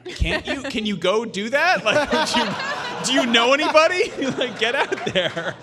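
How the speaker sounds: high, loud, laughing mom voice; laughing mock mom. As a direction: crack up while voicing mom. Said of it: "And she's like".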